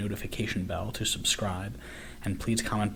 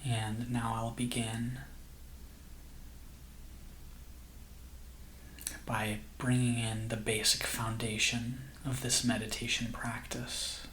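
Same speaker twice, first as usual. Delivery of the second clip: soft, slow meditation voice with long pauses; guided meditation; 7 dB quieter than usual. Acted. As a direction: gently guide listeners into calm, unhurried and hushed.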